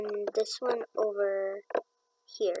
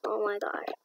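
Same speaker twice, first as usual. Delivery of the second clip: ordinary talk, nothing marked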